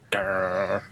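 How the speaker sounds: low, soft mock growl; imitated growl. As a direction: growl quietly, mimicking a grumpy friend. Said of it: "You sounded just like".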